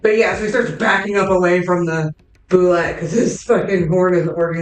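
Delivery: ordinary delivery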